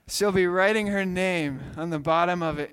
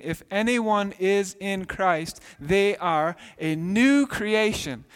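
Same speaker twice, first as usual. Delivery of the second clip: scripture reading; 4 semitones higher, 4 dB louder, slower than usual. Acted.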